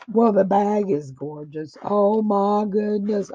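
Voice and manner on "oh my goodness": ordinary talk, nothing marked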